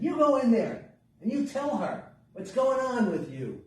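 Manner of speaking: high, loud motherly order; firm mother. A narrator's reading. Mother sends her little girl to the old woman, firm order. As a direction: send the child in with a loud, insistent push.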